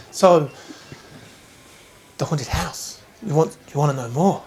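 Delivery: lightly voiced young gossip girl; a hint of teen-speak; LOL-girl voice. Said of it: "I put my best L O L voice on".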